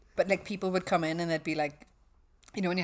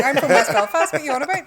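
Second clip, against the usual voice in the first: indignant retort; 7 semitones higher, 10 dB louder than usual. Retold or imitated.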